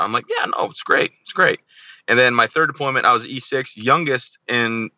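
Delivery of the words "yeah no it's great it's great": high-pitched, unconvinced agreement; mock enthusiasm. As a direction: go along with it in a higher voice. Retelling his reply to promotion.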